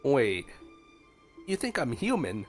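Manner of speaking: ordinary delivery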